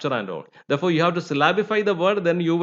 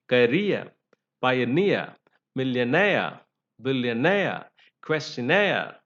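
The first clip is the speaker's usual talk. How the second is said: "career, pioneer, millionaire, billionaire, questionnaire", each stressed on its final syllable; stress on suffix; 3 semitones lower than usual. Shown as a demonstration. Teacher giving examples of the rule, stress on the suffix. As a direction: list "career" to "questionnaire" with pauses, swelling on each ending. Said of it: "stressed on the suffix".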